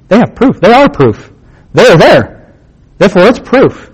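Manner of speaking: ordinary talk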